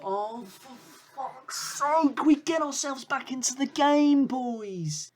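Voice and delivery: high, strained exasperated cry; frustrated outburst. Real, not acted. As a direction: let the frustration spill out high. Cursing team after conceding.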